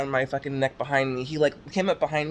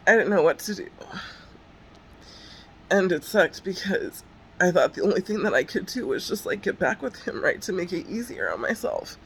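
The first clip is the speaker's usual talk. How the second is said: higher, upset and helpless; real distress pushing the voice up; 5 semitones higher than usual. Real, not acted. Stalking victim admitting she felt out of options, distressed.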